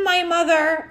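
shocked. Acted. Surprise at seeing mom on TV.

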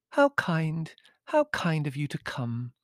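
put-on high, lilting girlish countess; musical little-girl voice. A narrator's reading. Countess greeting her guest, musical girlish voice. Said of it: "exclaimed in a musical little girl's voice".

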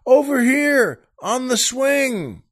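high, drawn-out distant holler; shouting back. Acted. Stranger shouting back to the husband, long high shout. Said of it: "the guy shouts back".

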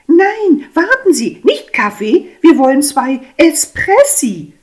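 very high, loud, insistent; wife protests to the waiter, very high and loud. Acted. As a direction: stop the waiter firmly.

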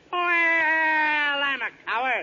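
whimpering slow cry; cowardly wail. Acted. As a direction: wail it like a scared child.

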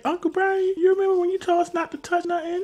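put-on high, fast kid voice; little girl voice. Girl reminding her uncle of his rule, high fast. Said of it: "she's like".

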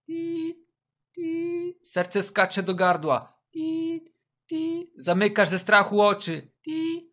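high-pitched beeping ring tone; imitated phone ring. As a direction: beep like a dial tone.

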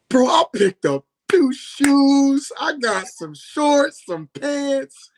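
high, slow, drawn-out listing; sing-song high-pitched excitement; excited retelling. Retold or imitated. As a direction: stretch each item out high, reliving the thrill. Reliving his free shopping spree.

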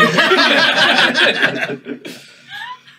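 loud, high burst of laughter; big high laugh. Real, not acted. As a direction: laugh hard at the end. Laughing at stream end.

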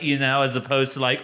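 ordinary delivery

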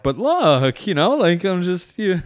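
high, loud, broken-up pleading; nervous. Retold or imitated. Husband defending himself to angry wife, nervous. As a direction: play a flustered husband stumbling for an excuse. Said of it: "I started getting nervous".

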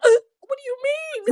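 high, loud, baffled cry; shocked disbelief. Retold or imitated. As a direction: gasp and blurt it out in shock. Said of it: "And I'm like".

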